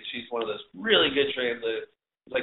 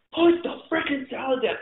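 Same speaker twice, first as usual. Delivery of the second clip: much higher mock yell at one stubborn cook; hammed-up order to put the salad down; retold angry yell; 12 semitones higher than usual. Chef yelling at the last cook still eating, mock furious. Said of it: "screaming at him to drop the salad".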